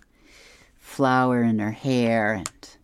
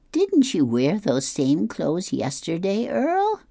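Opal voice; 8 semitones higher, 3 dB louder, slower than usual. Acted. Opal questions Earl.

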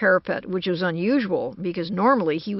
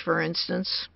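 usual speaking voice

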